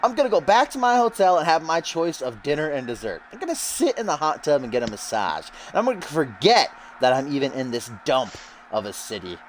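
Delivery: Russell Pontarelli. Acted. Commentator voices the newcomer snubbing the city, cocky wrestler voice. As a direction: start high and lofty, end dismissive. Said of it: "He says".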